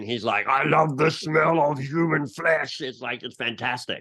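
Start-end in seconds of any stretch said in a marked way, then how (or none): 0.5-2.8 slow, menacing orc growl, higher than usual; drawn-out orc war-leader voice; orc general impersonation. Retold or imitated. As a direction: savour it like an orc. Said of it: "ask him to do his orc impersonation".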